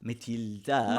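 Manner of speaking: imitating a Swedish Matilda; Swedish pronunciation. Host copying guest's name, imitated Swedish accent. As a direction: try Matilda with a Swedish lilt. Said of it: "say it in the Swedish way".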